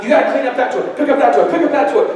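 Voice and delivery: nagging parent. Retold or imitated. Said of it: "I was exasperating the child".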